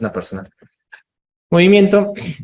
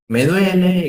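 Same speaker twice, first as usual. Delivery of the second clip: mock patient cry; 7 semitones higher, slower than usual. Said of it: "y grita".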